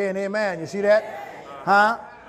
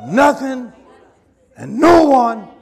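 preaching at full force; 6 dB louder, slower than usual. Acted. Preacher urging the church, at full force. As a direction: drive the exhortation home like a pulpit call.

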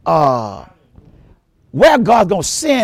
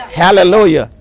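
ordinary talk, nothing marked

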